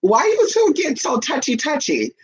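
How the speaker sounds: high, slow put-on woman's voice; suspicious drawn-out question, pitched high; high mock jealous girlfriend. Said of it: "she gonna be like".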